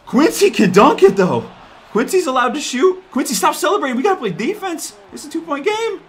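high frantic urging; excited. Real, not acted. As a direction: urge the team on, high. Frantic end of close game.

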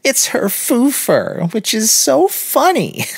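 put-on high, slow, tittering girl's voice; drawn-out high girlish mockery; giggling girl. A narrator's reading. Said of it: "giggled the girl".

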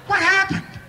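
startled outburst; shocked. Retold or imitated. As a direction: blurt it in sheer disbelief. Surprised nobody answers.

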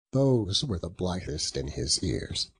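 plain reading voice

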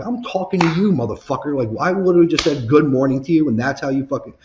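retold furious tirade, high and loud; snapped. Worker telling off his manager, snapped. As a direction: let the re-enacted rant climb into a shout. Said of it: "I just snapped".

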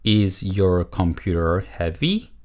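slow, word-by-word model question; model reading. Shown as a demonstration. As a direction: read it slowly for learners to repeat. Teacher models answer for repetition. (천천히 또박또박 읽는 시범)

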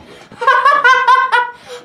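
loud, high, rapid laughter; long high laughing fit; gleeful laugh. Real, not acted. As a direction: burst out cackling in delight. Laughing at rival team's loss.

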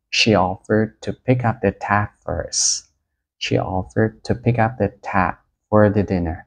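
slow, careful reading of the example; slow example sentence. Shown as a demonstration. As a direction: read it out slowly for students. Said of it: "Ví dụ như vậy". (đọc câu ví dụ thật chậm rãi)

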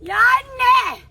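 loud cry for mother; wailing yell. Real, not acted. Prank victim crying out for mother, wailing yell. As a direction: holler out in distress. Cries out when hit.

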